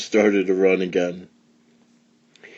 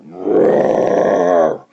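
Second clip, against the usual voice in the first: mock roar; 3 semitones lower than usual. A narrator's reading. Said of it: "Boris roared".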